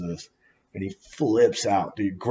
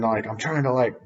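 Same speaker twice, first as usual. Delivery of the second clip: ordinary talk, nothing marked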